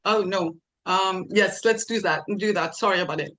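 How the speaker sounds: ordinary delivery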